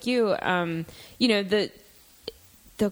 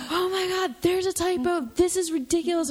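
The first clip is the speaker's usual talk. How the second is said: high-pitched inner panic; mock panic, pitched way up; freaking out; 11 semitones higher than usual. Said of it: "half of my brain is freaking out".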